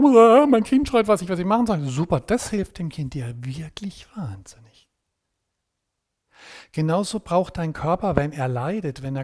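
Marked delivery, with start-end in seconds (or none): 0.0-1.8 mock panicked yell. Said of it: "jetzt schreist du im Wohnzimmer auch noch". 1.8-4.9 dry sarcastic whisper, slow; slow whispered irony. Real, not acted. Sarcastic aside after mock panic.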